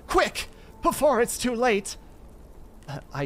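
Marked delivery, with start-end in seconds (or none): 0.1-2.0 high, loud urgent warning; tense anxiety. Acted. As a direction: urge him on, anxious and strained. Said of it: "it remained tense through anxiety for me".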